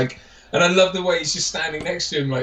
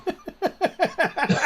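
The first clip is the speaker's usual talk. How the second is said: high cackling mock laughter; imitated hysterical laugh; 4 dB quieter than usual. Fan mimicking two film characters, laughing their heads off. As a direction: laugh your head off in imitation. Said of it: "they're both laughing their heads off".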